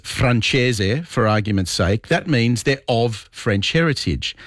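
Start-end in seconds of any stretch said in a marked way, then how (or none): 0.0-1.0 Francese said the Italian way, fran-CHEH-zeh; Italian pronunciation. Shown as a demonstration. Radio host mansplaining to a texter, Italian pronunciation of Francese. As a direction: say Francese slowly with an Italian accent. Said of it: "If I say in Italian".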